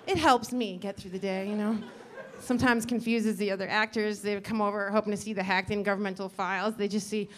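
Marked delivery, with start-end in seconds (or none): none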